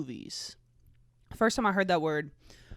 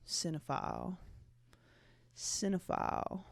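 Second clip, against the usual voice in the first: savoring the word; 9 dB quieter than usual. Shown as a demonstration. Vlogger savoring a favorite word, softly. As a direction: let the word slide off the tongue. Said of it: "almost like a little snake on your tongue".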